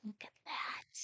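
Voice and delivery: hushed whisper. Acted. Grandma whispers to the child about the chicks. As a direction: point it out softly.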